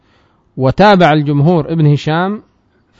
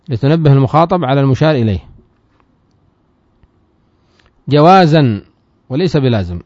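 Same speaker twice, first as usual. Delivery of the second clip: text recitation; 2 semitones higher than usual. Acted. Teacher reads the matn aloud to students, raised recitation. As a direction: declaim the source text to the class.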